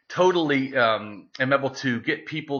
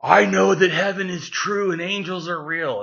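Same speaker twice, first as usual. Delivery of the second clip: imitating the drunk guy a little higher; lightly voiced drunk believer; 5 semitones higher than usual. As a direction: put on the drunk stranger's earnest claim. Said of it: "he's like".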